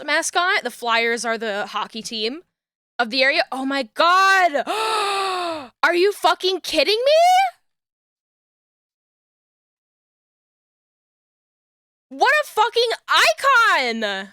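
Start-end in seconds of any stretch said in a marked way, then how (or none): 3.5-4.6 real awestruck yell, drawn out; excited yelling. Real, not acted. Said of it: "I'm, like, yelling". 4.7-5.8 big high-pitched gasp; excited gasp. Real, not acted. Overjoyed at mascot picture. 5.8-14.3 high-pitched yell of adoration; excited yelling. Real, not acted. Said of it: "I'm, like, yelling".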